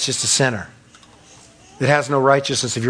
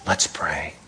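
mock pious hush; 13 semitones lower, 9 dB quieter than usual. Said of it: "compare yourself to all the people".

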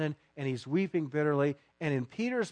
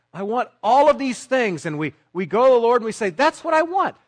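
louder and higher, a loud mock of a demanding believer; mock demanding shout; 6 semitones higher, 10 dB louder than usual. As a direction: rise to a demanding yell. Mocking believers demanding from God.

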